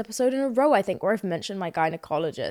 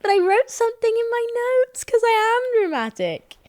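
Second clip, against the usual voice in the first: much higher, hammed-up theatrical voice; dramatic; 14 semitones higher, 3 dB louder than usual. Mocking herself to listeners, hammed-up dramatic voice. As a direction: ham up your own drama. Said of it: "Let me not be dramatic".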